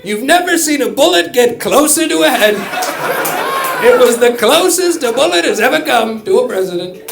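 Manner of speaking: loud, high boastful rally voice; shouted bragging stump speech; Trump impression. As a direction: brag about bullets like a showman. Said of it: "That's not the worst Trump impression".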